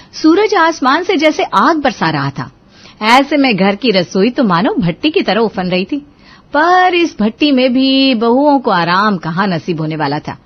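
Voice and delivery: loud, theatrical storyteller opening; dramatic, loud Hindi tale narration; story narration. A narrator's reading. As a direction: set the sweltering scene with big projection. Narrator opens a new story.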